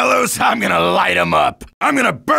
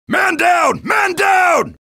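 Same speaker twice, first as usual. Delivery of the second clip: calling out; 7 semitones higher, slower than usual. Acted. Calling out a downed teammate.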